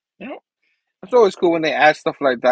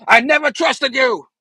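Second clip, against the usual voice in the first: raised, forceful heroic outburst; emphatic retort; 8 semitones higher, 3 dB louder than usual. Acted. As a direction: throw it at the villain, pitched up. Acted climax of hero's fight speech.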